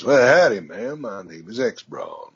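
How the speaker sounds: an off-road truck robot with a Southern twang; Southern accent. Retold or imitated. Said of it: "I gave him something Southern".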